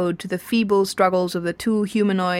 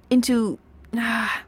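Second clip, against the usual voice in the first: crescendo giving out; 3 semitones higher, slower than usual. A narrator's reading. Arthur losing the thread of his praise, petering out. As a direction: run out of words mid-climax. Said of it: "which suddenly gave out on him".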